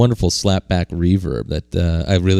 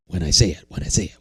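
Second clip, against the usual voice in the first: repeating echo dying away to a whisper; fading delay echo; 7 dB quieter, much faster than usual. Shown as a demonstration. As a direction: let the words bounce and fade out. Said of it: "it would go".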